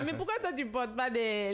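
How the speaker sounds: ordinary talk, nothing marked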